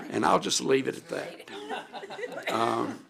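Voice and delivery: slow, laughing through the words; chuckling while remembering her mother. Real, not acted. Thanking the board for honoring her mother, laughing.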